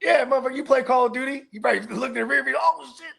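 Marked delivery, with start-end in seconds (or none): 2.6-3.0 higher, alarmed gasp of a realization; mock shock at spotting a gun; mock panic. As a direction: play the startled driver, half joking. Mock panic in rear view.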